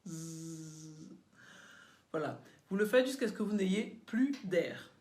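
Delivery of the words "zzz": low, soft buzzing Z like a vibrating phone. Shown as a demonstration. Singing teacher shows pupils the phone-buzz Z, low and soft. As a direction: hum a long Z through the teeth. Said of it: "on y va ensemble".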